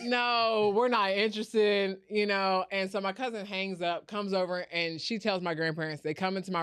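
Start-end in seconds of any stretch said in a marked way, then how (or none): 0.0-2.0 raised, emphatic brush-off; firm refusal. Retold or imitated. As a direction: shut the caller down loudly. Cousin cuts off caller, retold.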